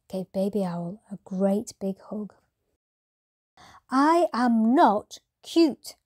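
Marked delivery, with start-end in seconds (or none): 3.9-6.0 high, loud and slow, stamping each word; an owlet yelling in protest; childish shout. A narrator's reading. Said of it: "shouted Baby Owl".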